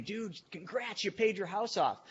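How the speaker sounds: fake-cheerful pat on the back, drawn out; mock congratulations.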